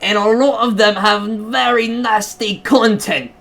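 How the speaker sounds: high, indignant mock British voice; British accent. Caller demanding nasty games leave Steam, indignant mock British accent. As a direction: complain in an exaggerated UK accent, stretching it out slowly. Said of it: "I'm gonna just do U K".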